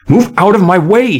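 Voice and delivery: high, loud desperate scream. A narrator's reading. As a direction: scream it as she shoves the figure. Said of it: "she screamed".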